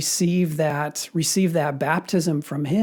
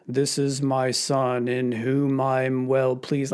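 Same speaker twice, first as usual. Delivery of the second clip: low, slow British monotone; solemn, droning British delivery; British deep monotone; 3 semitones lower, slower than usual. Retold or imitated. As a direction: intone it slowly in a deep British voice. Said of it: "the British deep monotone".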